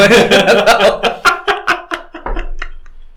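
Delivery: high rising laughter; laughing. Real, not acted. Laughs at his own joke.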